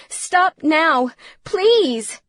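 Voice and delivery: loud, high-pitched begging; desperate plea. A narrator's reading. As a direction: beg him urgently to stop. Frightened mother begs him to stop.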